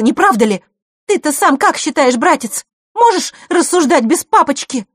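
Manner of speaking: high, loud, raging tirade; furious. A narrator's reading. Vasilisa lets loose at her brother, furious. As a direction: pour out the rage without easing off. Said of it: "в бешенстве выдохнула она".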